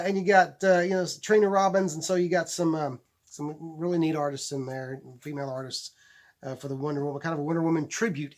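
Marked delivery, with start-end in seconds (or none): none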